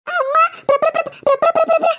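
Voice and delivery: high, rapid stammer on one syllable; too scared to get the word out; panicked, repeated stuttering; terrified stuttering. Acted. Terrified girl cannot finish the word.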